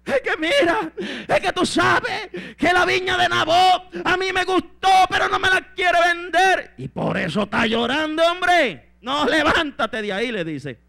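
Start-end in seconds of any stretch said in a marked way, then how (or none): 0.1-6.7 high, loud mock bawling; hammed-up whiny wailing; crying like a child. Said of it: "Se puso a llorar como niño". 6.8-10.3 loud mock scolding, rising; bossy wife's hammed-up rebuke; Jezabel scolding. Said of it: "Y le dice a Jezabel".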